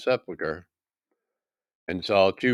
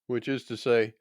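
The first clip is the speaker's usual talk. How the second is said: normal talking voice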